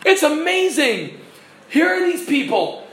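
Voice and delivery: high-pitched marvelling; excited preacher's wonder; amazed. Real, not acted. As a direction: marvel at it with delight.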